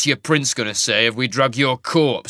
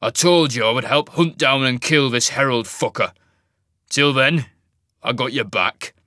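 louder, rough grudging reply; surly growl; 6 dB louder than usual. Acted. Jarek to Isaac, a loud surly promise.

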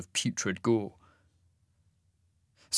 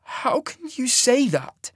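high, drawn-out strangled protest; slower than usual. A narrator's reading. Hurt young man questioning her rejection, in a strangled voice. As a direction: choke it out slowly, pitched way up. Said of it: "in a strangled voice".

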